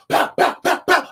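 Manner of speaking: breathy mock gunshot sounds; imitated gunshots. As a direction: spit out pow pow like shots.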